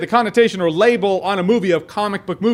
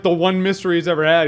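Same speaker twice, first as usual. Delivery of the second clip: usual speaking voice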